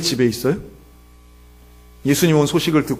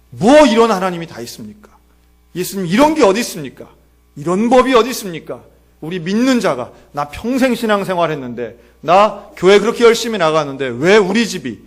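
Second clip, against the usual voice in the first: raised-voice remonstration; indignant protest; 4 semitones higher, 5 dB louder than usual. Acted. Longtime believer protests to God over family misfortune, indignant. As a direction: protest forcefully, building to a loud finish. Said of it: "라고 항변하는 겁니다".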